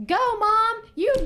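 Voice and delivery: a daughter rooting like a fan; cheering on. Retold or imitated.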